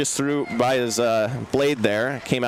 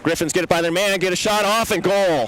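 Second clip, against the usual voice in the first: excited goal call; 5 semitones higher than usual. Real, not acted. Play-by-play of a goal.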